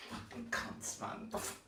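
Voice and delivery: whispered, bitter swearing under the breath; muttered curse. Real, not acted. Fan curses his team after a last-minute equalizer, whispered. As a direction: spit it out under your breath, voiceless.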